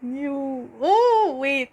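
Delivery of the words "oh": surprised oh. Real, not acted. Sudden realization mid-reading.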